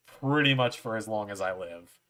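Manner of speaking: usual speaking voice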